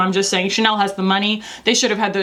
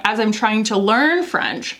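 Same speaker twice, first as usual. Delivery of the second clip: ordinary delivery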